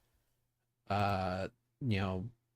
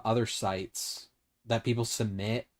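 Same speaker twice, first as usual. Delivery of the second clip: ordinary delivery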